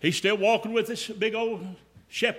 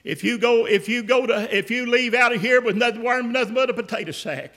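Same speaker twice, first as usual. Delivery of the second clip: usual speaking voice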